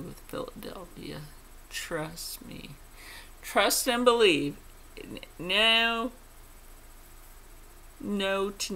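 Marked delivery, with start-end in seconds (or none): none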